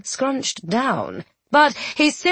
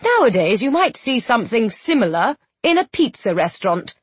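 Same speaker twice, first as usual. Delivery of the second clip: ordinary delivery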